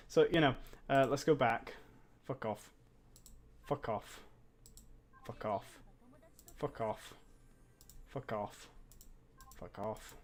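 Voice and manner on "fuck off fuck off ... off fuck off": low, soft repeated dismissal; muttered cursing. Real, not acted. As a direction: mutter it under your breath, over and over.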